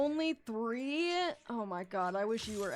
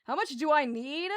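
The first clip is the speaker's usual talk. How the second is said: normal talking voice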